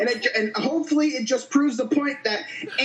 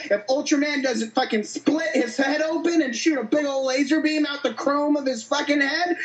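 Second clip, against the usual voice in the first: ordinary delivery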